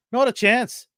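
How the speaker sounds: a hint of an Irish granny refusing; Irish old woman. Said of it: "Let me get my Irish accent out".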